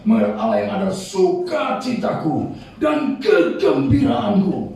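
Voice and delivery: slow, ringing proclamation; exultant scripture declamation. Acted. Preacher reading a psalm of joy, exultant slow declamation. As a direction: stretch and punch the words of joy.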